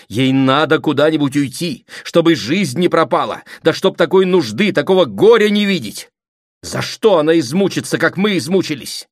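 loud, rising lament; impassioned plea. Acted. Sister pleading for Katya's escape, impassioned plea. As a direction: plead with mounting urgency.

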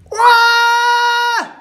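high, loud, unearthly shriek; the spirit screaming as it leaves the man; spirit's scream. A narrator's reading. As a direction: let out a piercing howl.

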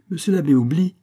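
usual speaking voice

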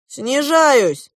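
high, loud pilot's call; announcing. A narrator's reading. Goose pilot announcing descent, calling out. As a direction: call it out to everyone aboard. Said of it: "объявил злой гусь".

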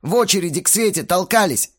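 high, loud exasperated explanation; heated yelling. A narrator's reading. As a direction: argue back at full heat. Belfigor keeps yelling at Astarot. (раздражённо доказывает, на повышенных тонах)

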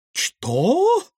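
very high, rising shocked question; startled. A narrator's reading. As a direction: gasp the question in alarm. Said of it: "Баронесса Васиковски вздрогнула и попятилась".

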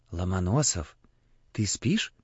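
soft, hushed bedside voice; whispering. A narrator's reading. Priest waking the sick boy at night, whispering. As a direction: hush it so only the sleeper hears. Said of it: "подошёл к Егорушке и зашептал".

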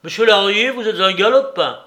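put-on old man's angry outburst; indignant cry. A narrator's reading. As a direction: hurl the insult, outraged. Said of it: "cria le père Soupe".

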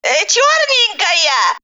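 high, slow granny call; old granny calling back. A narrator's reading. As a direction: call out from the kitchen, drawn out. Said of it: "откликнулась из кухни бабушка Рая". (бабушка откликается нараспев)

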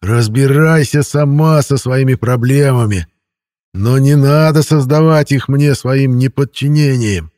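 slow, loud menacing hiss; hissing feral vampire. A narrator's reading. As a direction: keep hissing the threat. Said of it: "буквально прошипел вампир".

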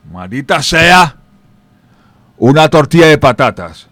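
mock angry curse. A builder cursing his lunch, loud and fed up. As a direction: spit out the disgust at the omelette.

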